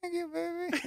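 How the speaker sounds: very high, soft assistant voice; Alexa reply. Retold or imitated. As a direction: answer sweetly as the device. Alexa answering his love.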